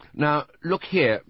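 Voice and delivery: high and slow, a touch of belligerence; slightly belligerent. Acted. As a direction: square up to him, measured and firm. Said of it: "a slight belligerence coming into her voice".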